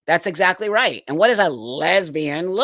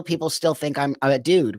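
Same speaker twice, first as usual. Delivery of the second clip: usual speaking voice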